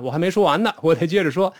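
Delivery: usual speaking voice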